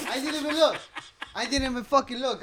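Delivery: high, loud and laughing; laughing exclamation. Real, not acted. Telling friends he didn't look, laughing loudly. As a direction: burst out laughing at how careless it was.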